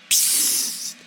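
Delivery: soft, unvoiced psst like leaves; rustling hush. Old oak hushes the hare, a rustling whisper. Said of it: "raschelte der Baum".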